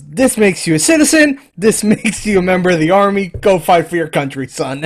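loud, high-pitched recruiter proclamation; mock-official, punched-out announcement; army recruiter. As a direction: declare it like an officer swearing in recruits. Acts movie army recruiter addressing immigrants.

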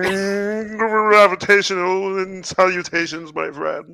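slow, hammed-up greeting after a long hum; hum, then draw the words out pompously; stage-character greeting. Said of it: "you have to start with".

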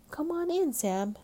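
high, loud coaxing call. A narrator's reading. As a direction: call a child into the water. Stella calls Sam into the water.

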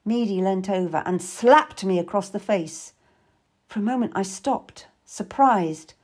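ordinary delivery

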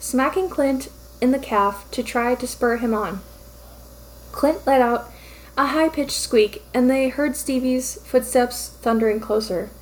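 ordinary delivery, nothing marked

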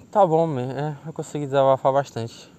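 normal talking voice

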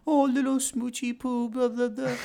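very high gushing dog greeting; mock pet voice. Mock greeting to the dog after a long day, high-pitched baby voice. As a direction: imitate a doting owner, way up high. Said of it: "you're greeting your dog and".

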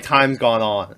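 usual speaking voice

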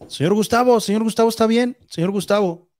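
high calls of the name through the door; guards calling out. Retold or imitated.

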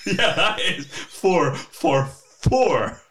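slow, laughing mock repeat.

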